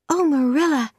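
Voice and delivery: put-on high excited girl's exclamation. A narrator's reading. Anne hears of guests coming to tea, excitedly. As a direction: exclaim with eager delight. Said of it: "said Anne excitedly".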